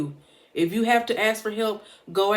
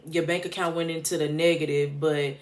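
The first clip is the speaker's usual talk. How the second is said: ordinary talk, nothing marked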